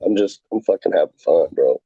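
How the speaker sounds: normal talking voice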